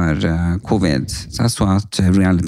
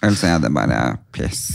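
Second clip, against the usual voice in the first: ordinary talk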